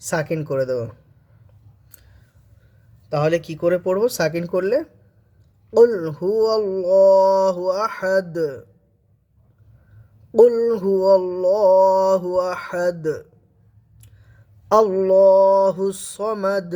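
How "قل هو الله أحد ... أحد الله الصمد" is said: slow, drawn-out melodic recitation; slow tajweed recitation; Quran recitation. Shown as a demonstration. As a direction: chant each word long and lifted.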